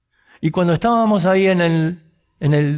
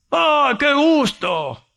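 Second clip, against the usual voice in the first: much higher, slow, stretched-out joyful "Oh"; delighted exclamation; 9 semitones higher, much slower than usual. Retold or imitated. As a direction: greet long-lost countrymen with delight. Argentine worker thrilled to meet compatriots.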